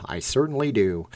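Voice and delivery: ordinary talk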